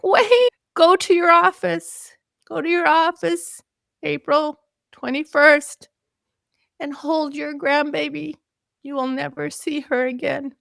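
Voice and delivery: high loud call fading to tearful; calling after her in vain, close to tears; high tearful urgent plea; desperate plea. Retold or imitated. Desperate call, she cries.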